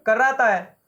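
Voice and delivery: usual speaking voice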